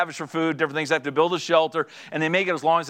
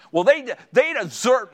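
gruff protest; 6 semitones higher, 3 dB louder, slower than usual. Retold or imitated. Gruff husband protesting to wife.

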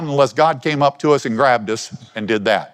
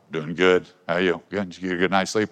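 lightly voiced low, soft porter; Bob the porter; 6 semitones lower, 5 dB quieter than usual. Porter greeting the shepherd in the morning, lightly acted low voice. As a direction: play a low-key morning greeting, pitched down. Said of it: "Bob's poor".

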